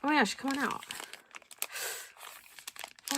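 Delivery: ordinary talk, nothing marked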